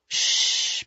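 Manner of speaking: soft hushing breath; soothing shush. A narrator's reading. Woman to shaking lover, soothing hush. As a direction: shush gently to calm someone.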